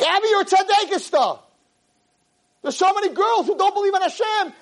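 very high, excited shout; exultant proclamation. Retold or imitated. Retells exclaiming his delight at Abby.

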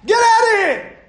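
high, loud commanding yell; yelling at the gunman to get out; shouting get out. Shown as a demonstration. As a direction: throw the intruder out with your voice. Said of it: "Tell him".

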